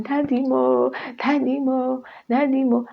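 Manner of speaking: high, pleading call for daddy; high crying call. Retold or imitated. Woman in pain calling for her late father, high and pleading. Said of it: "So I kept screaming".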